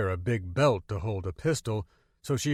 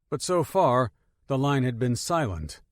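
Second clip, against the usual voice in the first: ordinary delivery, nothing marked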